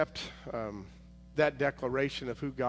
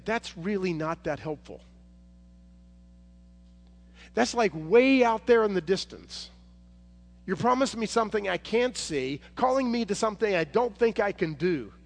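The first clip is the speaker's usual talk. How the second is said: louder and higher, pleading protest; exasperated Moses; 6 semitones higher, 5 dB louder than usual. Pretend. Moses protests to God, loud and exasperated. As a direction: push back hard at an impossible promise. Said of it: "if I'm Moses, I'm saying".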